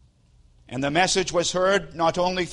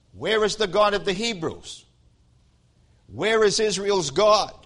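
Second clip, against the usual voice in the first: raised pitch, mildly mocking challenge; mocking cry; 5 semitones higher than usual. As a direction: call it out as a taunting reveler. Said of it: "crying out in a mocking voice".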